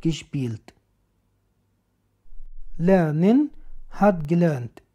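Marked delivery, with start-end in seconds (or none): none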